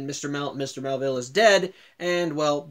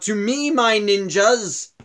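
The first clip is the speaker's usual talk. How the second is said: slow, raised summoning call; drawn-out villain's battle cry, higher and louder; yelling out; 6 semitones higher, 6 dB louder, much slower than usual. Retold or imitated. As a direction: call out grandly to your henchmen. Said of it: "he immediately yells out".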